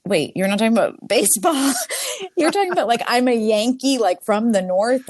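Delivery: high, incredulous question that finally clicks; dawning surprise. Retold or imitated. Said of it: "I'm like".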